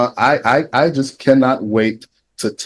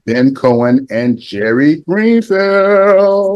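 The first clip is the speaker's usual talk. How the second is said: slow, drawn-out names rising high; big guest announcement; 5 semitones higher, 3 dB louder, much slower than usual. Real, not acted. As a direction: build to the last name and hold it. Host announcing final guests by name.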